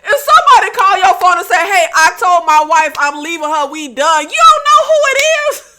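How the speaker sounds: loud, high, disbelieving rant with laughs; incredulous outburst. Real, not acted. Host reacts to Samantha's phone call.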